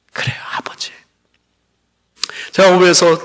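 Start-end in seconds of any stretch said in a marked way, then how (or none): 0.1-0.9 soft whispered "그래요, 아버지"; whispered reply. Retold or imitated. Son answering his father in a tender whisper. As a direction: breathe it as a hushed whisper.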